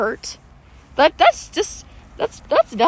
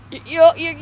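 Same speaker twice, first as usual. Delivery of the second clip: ordinary delivery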